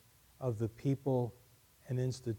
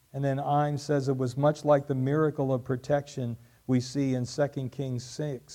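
normal talking voice

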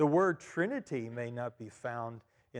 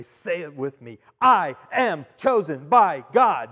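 slow, louder proclamation; preacher's call; 11 dB louder, slower than usual. Shown as a demonstration. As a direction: lead the congregation in a slow ringing chant. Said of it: "I'd say".

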